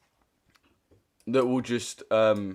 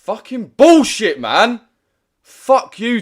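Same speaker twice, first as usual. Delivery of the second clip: loud, high, slow furious yell; real rage, shouted; 9 semitones higher, 8 dB louder, slower than usual. Real, not acted. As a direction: explode at the app. Streamer raging at TikTok ban.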